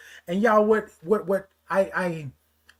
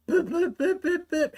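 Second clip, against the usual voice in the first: high, quick spoken beeps; imitated beeping; 10 semitones higher, 4 dB quieter than usual. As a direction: beep like a little machine, high-pitched. Imitated beeps before getting loud.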